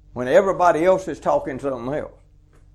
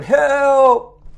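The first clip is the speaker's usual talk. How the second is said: loud mock cry of help, pitched up; hollering for help; 8 semitones higher, 6 dB louder than usual. Man dangling from a cliff, hollering help. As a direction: yell it like a man hanging off a cliff.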